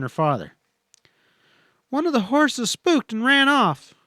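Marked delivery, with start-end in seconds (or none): 1.9-3.9 high, breathless alarm about the runaway horse; alarmed exclaim. A narrator's reading. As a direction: blurt it out, much higher, in alarm. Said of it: "she exclaimed".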